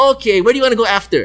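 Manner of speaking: high, quick party-goer impression; mock-keen invitation; imitated eager woman.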